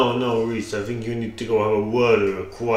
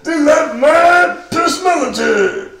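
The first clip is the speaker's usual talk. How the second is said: high, very loud character voice; over-the-top shouted officiant; eccentric shouting priest; 8 dB louder than usual. Acted. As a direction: bellow the introduction in a strained high voice. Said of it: "That's certainly unique, I must say".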